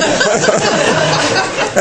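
a short high burst of laughter. Real, not acted. Still laughing at his own blunt words. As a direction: laugh helplessly.

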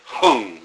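"hung" pushed out loud on a strong exhale; "hung" forced out from the abdomen; "hung" expelled loud and hard; forceful Hung. Shown as a demonstration. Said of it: "you're sort of pushing it out".

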